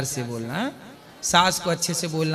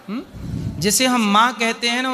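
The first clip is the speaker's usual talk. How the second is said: usual speaking voice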